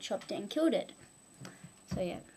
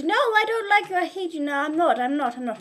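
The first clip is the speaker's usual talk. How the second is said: put-on furious child yell; 7 semitones higher, 7 dB louder, faster than usual. Blob yells at Mrs Orange.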